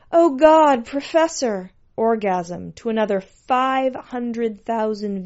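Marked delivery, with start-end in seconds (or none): 0.1-1.7 loud high-pitched cry; impassioned high character voice; ecstatic pet. A narrator's reading. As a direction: cry out in mock rapture. Narrator mimics pet's repeated cries.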